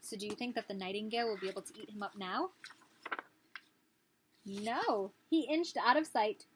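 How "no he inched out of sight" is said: ordinary delivery, nothing marked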